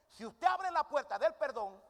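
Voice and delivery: loud, high and slow preacher's push; emphatic preaching. Acted. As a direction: drive each word home to the church. Preacher builds to emphatic point. (predicación enfática, fuerte, aguda y lenta)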